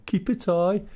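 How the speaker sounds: "Keep a tie" called out high and loud, rising, broad Brummie; broad Brummie shout. Retold or imitated. Customers teasing the shop worker, mock Brummie shout. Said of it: "they've started shouting".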